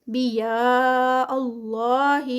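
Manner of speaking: بیا held slow and stretched; long madd. Shown as a demonstration. Teacher chanting for students, stretched. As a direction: stretch بیا before Allah. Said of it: "مد متصل ہے".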